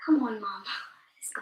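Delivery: hushed, voiceless prodding; whispered urging. Real, not acted. Child to Mum between quiz scores, whispered urging. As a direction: whisper it to hurry someone along.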